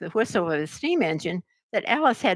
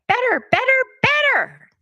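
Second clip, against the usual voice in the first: each better much higher and louder until it squeaks; rising to a squeak; 6 dB louder than usual. A narrator's reading. Queen's voice rising to a squeak, louder each time. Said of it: "Her voice went higher with each".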